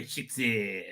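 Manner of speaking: SHIKSA said low and soft with disdain; demeaning. Shown as a demonstration. Host copying how Israelis say SHIKSA, demeaning. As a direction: drop SHIKSA down as a put-down. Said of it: "in a really demeaning way".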